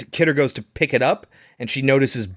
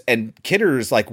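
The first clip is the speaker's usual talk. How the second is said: usual speaking voice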